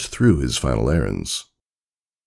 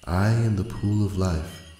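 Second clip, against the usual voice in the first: soft, higher, airy voice of a timeless spirit; ethereal pool spirit; 4 semitones higher, 5 dB quieter than usual. Acted. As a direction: murmur it as if from everywhere. The pool of life speaks.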